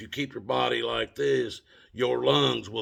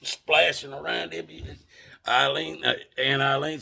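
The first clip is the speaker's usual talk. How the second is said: ordinary talk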